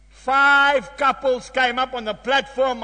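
usual speaking voice

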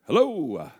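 loud, high jovial dad answering the phone; jovial greeting. Retold or imitated. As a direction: belt out a big cheery hello. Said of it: "his jovial".

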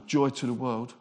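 low, glum mock delivery; miserable. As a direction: share good news as if miserable. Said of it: "they look really miserable".

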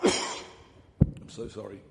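real cough, then apology; coughing, then apologising; tickly cough. Real, not acted. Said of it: "Tickle in my throat".

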